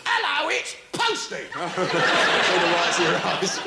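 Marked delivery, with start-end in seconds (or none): 0.0-1.3 postman voice. Retold or imitated. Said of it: "the bloke says".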